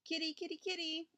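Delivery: high-pitched coaxing call to a cat; inviting call. A narrator's reading. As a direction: call a kitten over in a high voice. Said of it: "everybody called invitingly".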